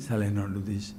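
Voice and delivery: the hesitant half of the inner debate; doubting answer. Acted. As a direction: answer yourself in a low, hesitant voice. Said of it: "Hamletian human conflict".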